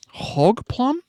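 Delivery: high rising hog plum, disbelieving; incredulous. Real, not acted. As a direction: echo it in disbelief. Incredulous repeat of word.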